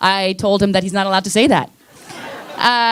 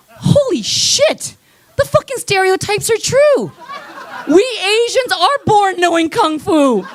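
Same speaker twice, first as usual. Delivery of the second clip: mock amazed discovery; 9 semitones higher than usual. Retold realisation in mugging.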